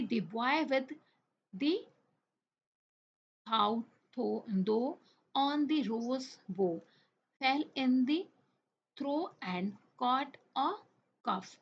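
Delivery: very slow, word by word, with pauses; careful tongue-twister demo. Shown as a demonstration. Teacher models reading a tongue twister. (टंग twister को धीरे-धीरे, रुक-रुककर पढ़ना)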